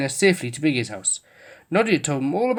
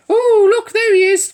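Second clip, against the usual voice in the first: much higher, louder awed cry; cried in awe; 8 dB louder than usual. A narrator's reading. Toys spotting Father Christmas, crying out in awe. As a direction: gasp it out at the sight. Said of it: "cried the toys in awe".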